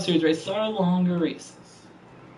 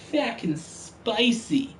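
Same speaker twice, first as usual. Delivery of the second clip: slow drawl; 5 semitones higher, slower than usual. Real, not acted. Drawn-out reaction.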